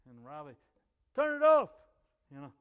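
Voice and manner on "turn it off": loud, high, urgent shout; re-enacted full-voiced yell; retold yell. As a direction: holler the order across the house. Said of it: "I was yelling under the house".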